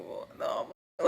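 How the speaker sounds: breathless hushed shock; whisper. Retold or imitated. Whispering at the woman who won't hit her. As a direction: mouth it in disbelief. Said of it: "so I was like".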